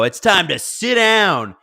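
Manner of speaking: slow, drawn-out arena announcer call; stadium announcer. Retold or imitated. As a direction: stretch it out high like a lacrosse PA announcer. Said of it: "the announcer will go".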